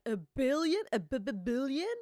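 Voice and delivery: stunned, stuttering question; stammering disbelief. Acted. Acting out a character hearing the treasure's worth, stammering disbelief. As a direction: trip over the b in mock shock.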